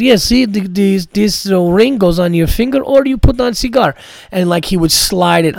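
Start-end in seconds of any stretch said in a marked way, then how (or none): 0.0-3.9 put-on higher, louder proud Armenian accent; Armenian accent impression. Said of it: "imitating this guy".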